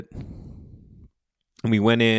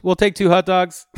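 put-on kid voice; 8 semitones higher, 6 dB louder than usual. Little son ordering hot dogs.

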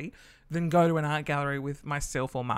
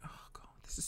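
breathy, hushed complaint; a gallery-goer whispering that the piece is dull; bored whisper; 18 dB quieter than usual. Retold or imitated. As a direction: whisper it so no one in the gallery hears.

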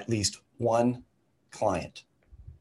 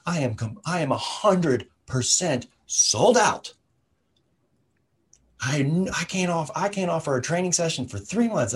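higher, bouncing, thrilled retelling; higher, breathless excitement about being sold out; excited trainer; 7 semitones higher than usual. Retold or imitated. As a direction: imitate a young coach bursting with news. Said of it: "he was all excited".

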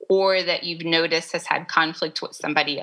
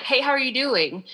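pitched up, casual hey; higher bright greeting; 7 semitones higher than usual. Shown as a demonstration. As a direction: ask it lightly, up high. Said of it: "tone makes a huge difference in that".

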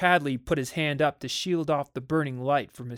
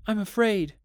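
high, frightened admission; tearful fear; 6 semitones higher, 3 dB louder than usual. A narrator's reading. Frightened wife comes to her husband for comfort, tearful. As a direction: confess the fear in a raised pitch. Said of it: "and cried steadily".

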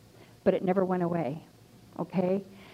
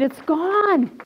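high, triumphant declaration; acted jubilant outburst; exultant exclamation; 7 semitones higher than usual. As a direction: proclaim it with raised pitch. Repeats realization emphatically, raised voice.

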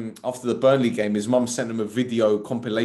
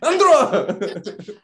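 put-on high, loud Greek-accented holler; mock Greek mum yell; 7 semitones higher, 5 dB louder, slower than usual. Impersonating a mum summoning her son, loud high mock yell.

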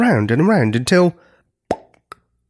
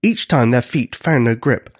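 plain reading voice

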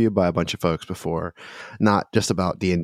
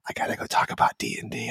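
quick, secretive whisper; hurried whisper; 6 dB quieter, faster than usual. Retold or imitated. Professor ducking out on a student to play D and D, quick whisper. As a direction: whisper it fast, like you're slipping away.

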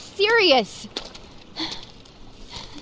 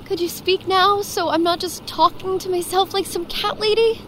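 normal talking voice

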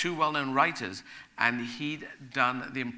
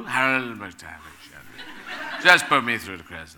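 laughing through the put-on agent voice; bad impression breaking into laughs; 3 semitones lower, 6 dB louder, much slower than usual. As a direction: fake agent voice cracking up with laughter. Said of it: "a bad impression of him".

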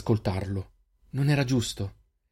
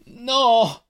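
loud, high cry of refusal; anguished shout; 12 semitones higher, 5 dB louder than usual. A narrator's reading. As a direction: shout it out against the voices. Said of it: "urlò Selin". (urlo acuto e disperato)

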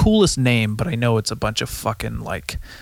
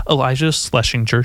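normal talking voice

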